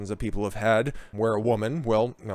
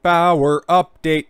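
slow, drawn-out show announcer; 5 semitones higher, 5 dB louder, much slower than usual. Pretend. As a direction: announce it like a segment title. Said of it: "that was pretty cringe".

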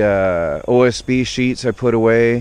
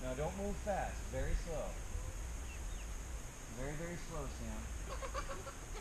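quiet herding talk, much softer; hushed coaxing; 4 semitones higher, 21 dB quieter than usual. Real, not acted. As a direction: murmur instructions to Sam without spooking the birds. Coaxing chickens back to coop.

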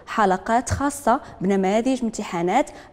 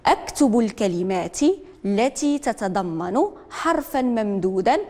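slow, deliberate reading of the instruction; teacher dictating the task word by word; slow dictation; slower than usual. Shown as a demonstration. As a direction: read it out slowly for young pupils. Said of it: "teacher dictates the instruction".

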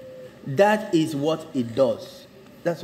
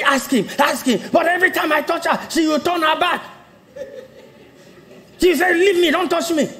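high-pitched, heated mock complaint; aggrieved husband; 13 semitones higher than usual. Husband complains to pastor about wife, high-pitched mock grievance.

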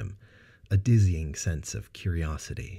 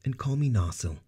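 warm; 8 semitones higher than usual. Acted. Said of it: "he replied warmly".

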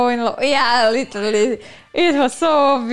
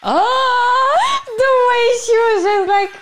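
high gushing delight; excited squeal; 9 semitones higher than usual. Real, not acted. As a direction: squeal over the cutscene. Gamer gushing at a cutscene.

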